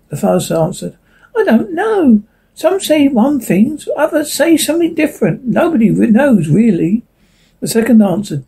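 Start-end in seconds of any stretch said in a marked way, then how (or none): 1.3-7.0 raised, wondering leaf voice; uncertain leaf voice. A narrator's reading. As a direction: muse it as a small leaf. Leaf character voicing.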